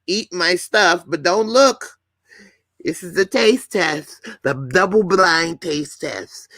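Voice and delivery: mock a shy actress clutching the sheets; mock coy woman. Host mocking the actress who stays covered, high prissy falsetto.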